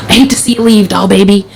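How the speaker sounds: lightly voiced gruff South Philly dad; a hint of gruff South Philly in a fond imitation; gruff South Philly accent. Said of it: "in his gruff South Philly accent".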